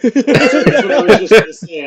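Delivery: loud laughing words. Real, not acted. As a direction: laugh through the line. Laughing agreement.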